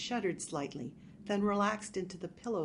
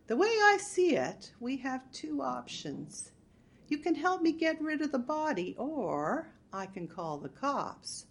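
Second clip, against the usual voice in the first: sweet threat; 7 semitones higher than usual. Acted. Marge calmly threatening burglar.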